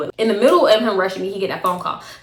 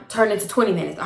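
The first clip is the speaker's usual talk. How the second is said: ordinary delivery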